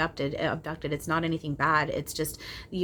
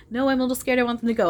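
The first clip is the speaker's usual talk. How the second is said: mock scared plea; 7 semitones higher, 6 dB louder, much faster than usual. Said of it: "I was like".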